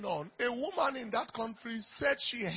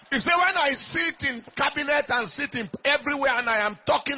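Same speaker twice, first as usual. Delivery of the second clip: loud ringing sermon cadence, climbing high; big fervent pulpit delivery; preaching at full force; 4 semitones higher, 7 dB louder than usual. Acted. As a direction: preach it out to the whole church. Pastor quoting at full force.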